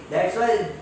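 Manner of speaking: ordinary delivery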